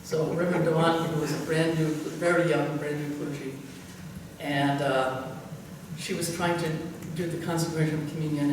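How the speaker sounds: normal talking voice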